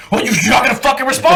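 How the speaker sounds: high, fast mock tirade; imitated sergeant yell. Sergeant demanding a response, mock angry yell. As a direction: rush the demand at high pitch. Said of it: "He was like".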